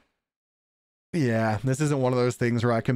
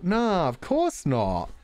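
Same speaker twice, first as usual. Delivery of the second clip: sarcastic; 11 semitones higher, slower than usual. Real, not acted. Sarcastic retort.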